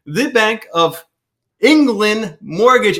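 lightly attempted British accent. Host introduces guest's company, trying a British accent. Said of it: "tried to do my British accent".

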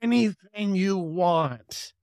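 high-pitched smooth host making an offer; tempting devil. Acted. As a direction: dangle it like a tempter, stretching the words out. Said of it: "and the devil's there. He's like".

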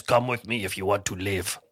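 put-on low, quiet Austrian-accented movie cyborg; deadpan robot accent, dropped low; Terminator. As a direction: imitate the action-film accent, deep and soft. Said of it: "in that accent".

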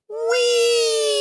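high, loud delighted squeal; squealing whee as the rope drops; gleeful whee. Acted. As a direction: let it fly like a playground cheer. Letting go of the line.

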